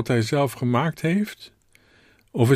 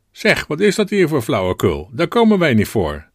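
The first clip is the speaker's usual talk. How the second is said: louder, higher protest from the pews; indignant calling out; 5 semitones higher, 6 dB louder than usual. A narrator's reading. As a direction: call it out like an affronted churchgoer. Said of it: "stond er een Heerenveense meneer op en riep".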